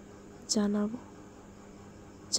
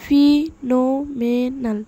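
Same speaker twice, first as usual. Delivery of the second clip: ফিনোমেনাল loud and slow, each syllable separate; 7 dB louder, slower than usual. Shown as a demonstration. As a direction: punch out every syllable of ফিনোমেনাল with gaps between. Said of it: "সিলেবলগুলো ভেঙে ভেঙে". (ফিনোমেনাল জোরে, ধীরে, ভেঙে ভেঙে)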